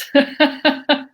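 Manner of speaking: a quick amused laugh; short laugh. Real, not acted. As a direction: laugh off the teasing. Playful aside to David.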